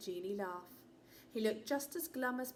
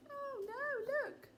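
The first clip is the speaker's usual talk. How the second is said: much higher, squealing alarm; elephant in a fright. Acted. Narrator voicing the frightened elephant, high and squealing. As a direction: yelp it in mock panic.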